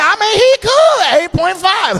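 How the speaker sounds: high, loud girly imitation; mock-casual high voice; mock girlfriend voice. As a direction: rate him like a shrugging girlfriend. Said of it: "She be like".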